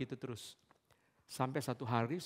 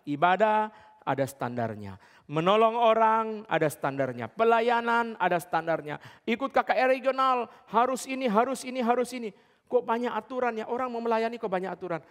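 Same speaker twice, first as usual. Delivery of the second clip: higher, louder pulpit proclamation; preaching at full force; 8 semitones higher, 4 dB louder than usual. Acted. Preacher drives home that service has standards, fervent. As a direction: hammer each standard home to the congregation.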